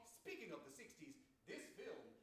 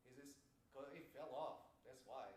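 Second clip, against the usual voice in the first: ordinary delivery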